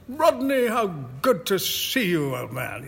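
hearty upper-class squire greeting; booming posh country gent, high start; squirish act. Retold or imitated. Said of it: "put on this squirish act".

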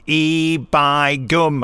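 slow, drawn-out Yorkshire "E by gum"; northern accent. Retold or imitated. The cast teases the actor with a slow northern "E by gum". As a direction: stretch "E by gum" slowly in a broad northern accent. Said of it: "the entire cast going".

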